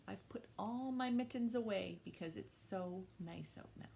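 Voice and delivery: ordinary talk, nothing marked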